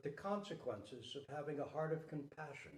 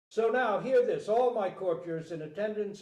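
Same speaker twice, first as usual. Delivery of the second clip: raised, higher voice calling out to the court; loud proclamation; 6 semitones higher, 11 dB louder than usual. Acted. King announces to all courtiers, loud. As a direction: command the room's attention.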